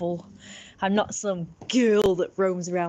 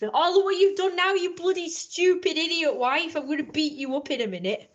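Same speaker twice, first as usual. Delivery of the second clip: high scolding that drops and softens; hammed-up husband's rant, winding down; abusive husband; 8 semitones higher than usual. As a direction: tell off the wife as her abusive husband. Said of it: "That's him saying it to his wife".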